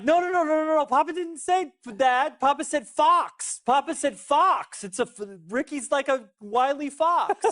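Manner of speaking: high, stretched-out insistence; retold panicked correction. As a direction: drag each word out high, pleading to be believed. Retold frantic correction of toddler.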